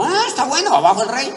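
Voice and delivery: high, loud agreeing yell; a second rebel picks up the shout; crowd shout. Retold or imitated. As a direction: join the cry with gusto. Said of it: "Y dijo otro".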